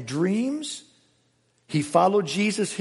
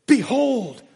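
high, loud, ringing proclamation; booming command; 6 semitones higher, 4 dB louder than usual. Acted. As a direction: announce it like a herald. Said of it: "behold's an imperative too".

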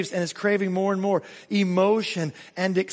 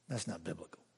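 much lower and much softer, under the breath; a private grumble to yourself; muttered aside; 13 semitones lower, 18 dB quieter, faster than usual. Retold or imitated. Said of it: "I always look at them and go".

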